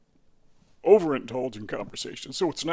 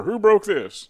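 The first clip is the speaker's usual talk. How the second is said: mock parent; 5 semitones higher, 7 dB louder, slower than usual. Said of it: "your parents would say".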